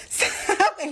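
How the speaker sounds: laughing. Real, not acted. Laughs while retelling the story.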